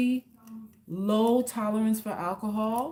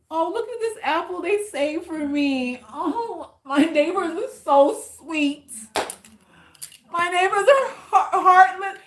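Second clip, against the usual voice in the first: much higher, mock-sweet, laughing through it; sarcastic gushing; 8 semitones higher, 6 dB louder than usual. Mocking the neighbors who left one apple, sarcastic sweetness. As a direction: gush about the neighbors while meaning the opposite.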